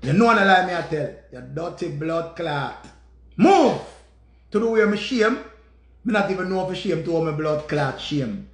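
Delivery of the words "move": sudden loud, high shout; angry command. Real, not acted. Man cursing Stacy, angry shouted command. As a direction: fire out one sharp order.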